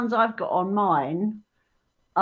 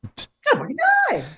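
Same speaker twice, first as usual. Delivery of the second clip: squeaky mock doom; faster than usual. Echoing the quote, high and fast.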